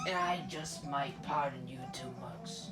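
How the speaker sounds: put-on devil voice; scheming devil. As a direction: lay out the deal like the devil.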